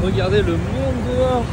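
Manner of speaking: excited. Real, not acted. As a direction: call out at the sight. Marvelling at the crowd outside. (émerveillé, plus fort)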